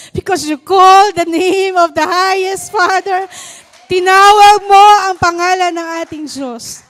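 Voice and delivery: loud, slow preacher's declaration; loud, slow sermon delivery; fervent preaching. Acted. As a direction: proclaim it to the congregation. Preaching to the congregation.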